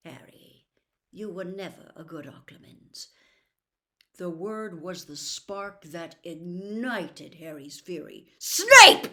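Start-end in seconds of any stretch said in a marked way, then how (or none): 0.1-3.1 soft, near-whispered, knowing; hushed knowing rebuke. Acted. Dumbledore quietly telling Harry he can't hide it, hushed rebuke. 8.4-9.1 explosive shout, much higher and louder. Acted. Harry naming the traitor to Dumbledore, furious outburst. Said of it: "he said very loudly".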